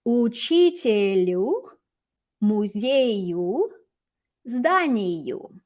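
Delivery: Учителю, музею, зданию spoken slowly, each word set apart; model pronunciation. Shown as a demonstration. Teacher revealing answers, slow careful Russian model. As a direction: read each dative answer out slowly and clearly. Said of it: "And then I'll show you the answers".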